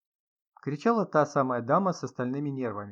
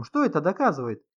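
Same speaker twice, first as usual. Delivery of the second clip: loud, raised-pitch challenge; agitated; 6 semitones higher, 7 dB louder than usual. A narrator's reading. Artyom accuses the host over fingerprints, agitated. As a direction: build into a higher, louder accusation. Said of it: "заволновался вдруг Артём".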